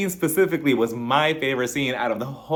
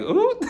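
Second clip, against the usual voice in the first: high-pitched scandalized "ooh" with a giggle; squealed ooh breaking into laughter; teasing ooh; 3 dB louder than usual. Retold or imitated. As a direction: let out a high, amused ooh. Mock reaction to innuendo.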